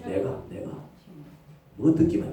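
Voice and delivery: ordinary talk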